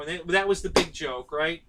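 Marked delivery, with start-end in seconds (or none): none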